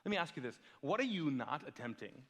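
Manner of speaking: normal talking voice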